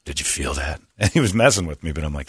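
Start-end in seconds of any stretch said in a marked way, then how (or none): 0.0-0.8 cop's spooky low hush. Retold or imitated. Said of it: "He goes".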